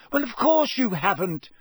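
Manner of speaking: high, loud, brisk retort; businesslike, pitched well up. A narrator's reading. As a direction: brush the remark aside briskly. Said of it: "said Hermione briskly".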